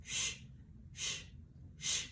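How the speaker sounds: "sh" hissed softly with no voice; soft whispered consonant drill; hushed sh sound. Shown as a demonstration. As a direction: breathe out "sh" quietly. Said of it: "Repeat after me".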